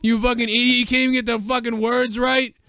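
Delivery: mock Hogan cursing out the caller. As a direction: scream it like a raging wrestler. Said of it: "he'd be like".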